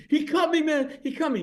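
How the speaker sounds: high, loud mock scream; hammed-up panicked yell; retold scream. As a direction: scream like a drunk who's been cut. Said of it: "he starts screaming".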